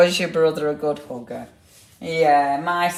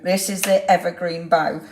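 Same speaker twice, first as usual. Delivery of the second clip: ordinary talk, nothing marked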